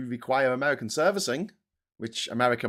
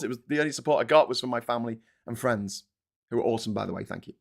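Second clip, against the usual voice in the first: ordinary delivery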